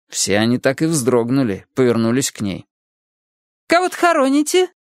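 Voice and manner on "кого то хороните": put-on high prying old lady voice; pry at the kids in a high nosy voice; nosy neighbour. A narrator's reading. Neighbour quizzes the children at the grave, high prying voice. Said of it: "nosy neighbour questions children".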